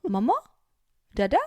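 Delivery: short questioning calls, rising at the end; tentative child's call, each word a question; searching toddler. Retold or imitated. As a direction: imitate a little one looking for parents. Toddler searching for parents, retold.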